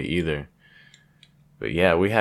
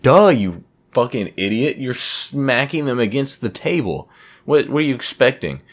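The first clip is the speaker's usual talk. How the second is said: higher, louder exasperated jab at himself; mock self-scolding; 7 semitones higher, 4 dB louder than usual. Scolding himself for drumming on the table, mock exasperated. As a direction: scold yourself like an idiot who should know better.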